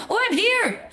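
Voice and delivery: high excited arrival; excited squeal. Real, not acted. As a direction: pop back in with a squeal. Reappearing in filter.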